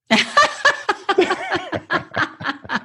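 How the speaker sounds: high, helpless laugh at the punchline; burst of laughter. Real, not acted. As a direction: crack up laughing. Punchline lands, teller bursts laughing.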